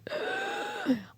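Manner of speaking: sharp mock gasp; imitated gasp. Client reacting to the stylist's question, imitated gasp.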